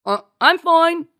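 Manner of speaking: loud, slow, high bluffing captain; captain impersonation. A narrator's reading. Marcy reassures crewman as fake captain, bluffing loudly. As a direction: bluff loudly, drawing the words out. Poses as captain through door.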